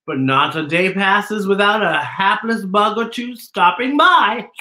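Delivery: put-on a gloating spider reads its note to the children; sly spider letter.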